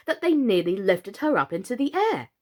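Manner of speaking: plain reading voice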